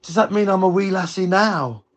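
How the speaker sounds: high-pitched imitation of mum; mum's questioning voice. Mum stops to ask her child, imitated wondering. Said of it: "she went".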